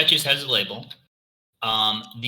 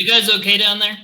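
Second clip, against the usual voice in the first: calling out; 9 semitones higher, 7 dB louder than usual. Acted. Lord Grumba calls from upstairs.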